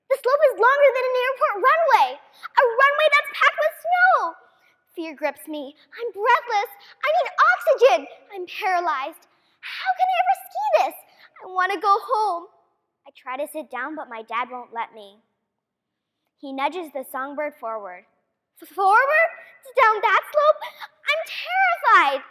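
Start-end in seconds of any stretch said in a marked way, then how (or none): 0.1-4.4 dramatic build. Acted. 6.0-11.0 put-on panicked child. Child fear scene. 18.6-22.2 high, loud frightened stammer; a scared kid at the top of the slope; terrified child. Acted.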